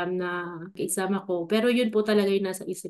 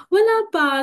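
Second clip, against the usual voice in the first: pitched-up, louder self-quote; high voiced reply; 10 semitones higher, 5 dB louder than usual. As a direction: answer mom in a high little voice. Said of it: "sabi ko ganun". (mataas na boses na sagot)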